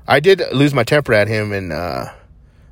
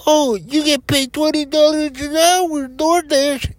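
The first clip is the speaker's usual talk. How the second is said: much higher, slow, sing-song ad pitch; mocking ad voice; slower than usual. Mocking DoorDash ads promising twenty an hour, high parody voice. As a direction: parody a cheesy recruitment ad. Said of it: "All those ads".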